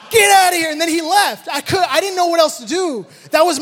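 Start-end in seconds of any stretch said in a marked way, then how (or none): 0.1-0.7 loud, high, angry shoo; shooing the goat. Retold or imitated. Dad chasing the goat off, loud angry shout. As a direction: drive the goat off, sharp.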